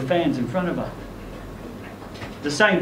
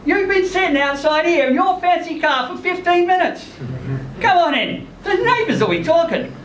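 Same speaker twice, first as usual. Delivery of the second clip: higher woman's voice, teasing, laughing at the end; Lynn teasing; 10 semitones higher than usual. Acted. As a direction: tease him warmly for lurking outside. Narrator voices Lynn at window.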